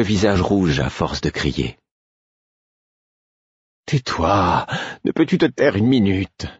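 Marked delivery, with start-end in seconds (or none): 3.9-6.5 high-pitched exhausted snap of exasperation; exaspération épuisée. A narrator's reading. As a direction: plead for silence, worn out and exasperated. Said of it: "d'une voix effrayante, tant on y sentait d'épuisement et d'exaspération". (exaspéré, à bout de forces)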